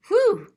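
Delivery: loud high puff of relief; mock whew. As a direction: blow out like after the stairs. Tired from the stairs.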